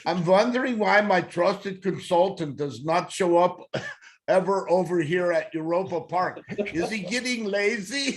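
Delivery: reading the letter in a hammed-up German accent; mock German accent. Host reading a listener's letter to Chip, in mock German accent. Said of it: "I'll do it with my best German accent".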